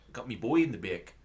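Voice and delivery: put-on Australian dad from the commercial; imitated Australian accent. Friend retelling the road safety commercial, imitated Australian accent. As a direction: quote the dad with Australian vowels. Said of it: "And then the guy's".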